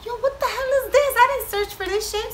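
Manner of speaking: high startled yelp, half laughing; shocked outburst. Retold or imitated. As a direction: react like you just got ambushed by a video. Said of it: "And I was like".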